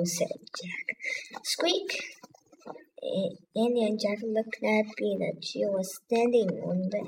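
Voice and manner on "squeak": single high mouse squeak; one high squeak. A narrator's reading.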